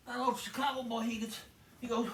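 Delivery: ordinary talk, nothing marked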